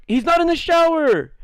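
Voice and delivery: very high mock tattle; tattling shout. Kids reporting the boy to the teacher, high yell. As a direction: yell it out to the coach.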